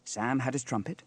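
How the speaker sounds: plain reading voice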